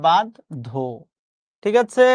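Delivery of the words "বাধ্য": "বাধ্য" split into bad... dho, lower; pronunciation demo. Shown as a demonstration. Teacher pointing it out, "বাধ্য" broken as bad-dho. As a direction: separate "বাধ্য" into two syllables, bad-dho. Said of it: "এই যে".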